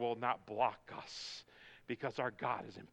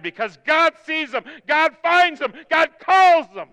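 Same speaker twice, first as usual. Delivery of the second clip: high, loud revival shout; full-force preaching; 14 semitones higher, 21 dB louder than usual. Real, not acted. Preacher urging families to get excited, full-force preaching. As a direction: build to a ringing preacher's shout.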